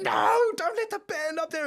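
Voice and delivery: high, loud alarmed protest; hammed-up alarm at a terrible idea; mock panic. Tour manager begging the pilot not to let the band fly, high mock panic.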